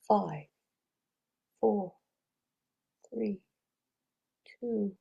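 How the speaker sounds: slow, soft countdown with long pauses. Shown as a demonstration. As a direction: count down slowly, letting each number settle. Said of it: "try doing it a little slower".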